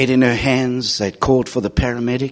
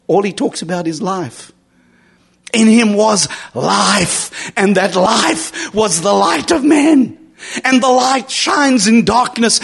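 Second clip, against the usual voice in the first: higher, louder, slowed pulpit proclamation; preaching at full force; 12 semitones higher, 4 dB louder than usual. Acted. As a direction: lift the words like a revival preacher. Climax of sermon, quoting John.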